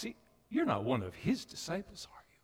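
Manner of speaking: unvoiced whisper, pointed; whispered accusation. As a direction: hiss the suspicion. Accusing men at fire.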